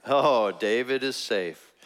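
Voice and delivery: slow, unimpressed fake cheer; sarcastic mock relief. Teacher doubting Saul's repentance, sarcastic slow cheer. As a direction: drag it out, not buying it.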